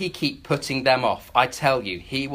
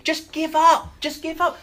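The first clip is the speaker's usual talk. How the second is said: urging to give up; 12 semitones higher, 3 dB louder than usual. Retold or imitated. Imagined voice urging surrender.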